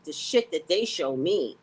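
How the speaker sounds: ordinary talk, nothing marked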